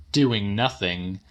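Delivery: ordinary delivery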